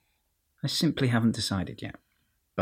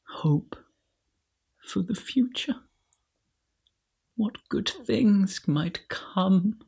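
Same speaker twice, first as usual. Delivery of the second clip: dreamy hushed hope; 10 semitones higher, slower than usual. Acted. Dreamy hushed wish for future.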